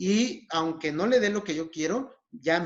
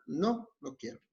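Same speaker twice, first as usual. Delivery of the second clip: softer, unbudging no; firm, even refusal; 5 dB quieter than usual. Shown as a demonstration. Patient refusing a pushy cigarette beggar, firm and even. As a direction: refuse without raising your voice. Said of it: "con el mismo tono de voz".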